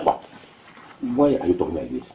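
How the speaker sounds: ordinary talk, nothing marked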